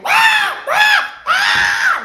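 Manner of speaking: high shrieking ah ah ah; mock scream. Friend retelling her screaming kayak fall, shrieking. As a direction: shriek like falling down a rapid. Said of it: "she's screaming".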